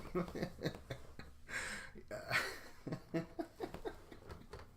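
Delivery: long wheezing laugh; breathless laugh; real laughter. Real, not acted.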